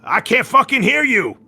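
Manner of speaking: bellowing commander demanding volume; drill sergeant yell. Acted. Officer demands louder response.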